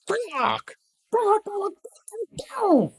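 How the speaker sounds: very high parrot squawks; raucous parrot. A narrator's reading. Parrot greeting the morning, raucous squawk. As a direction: squawk like a raucous bird at dawn. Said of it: "greeted the morning with a raucous".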